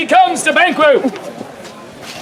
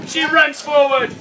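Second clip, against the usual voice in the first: normal talking voice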